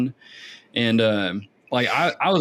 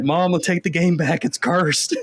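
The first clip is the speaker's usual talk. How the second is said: high, hammed-up plea to mom; playful, higher-pitched alarm; mock panic; 7 semitones higher, slower than usual. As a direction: imitate a spooked kid begging for help. Retold kid panicking to mom.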